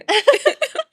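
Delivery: squealing burst of laughter; high giggle. Real, not acted. Laughing at her own joke.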